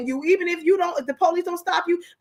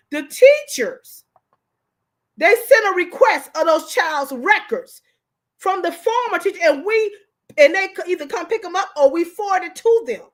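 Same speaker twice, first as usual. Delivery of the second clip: raised, high-pitched ranting; worked-up screaming; 3 semitones higher, 4 dB louder than usual. Real, not acted. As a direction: push your voice up and out, upset and insistent. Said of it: "Why am I screaming?".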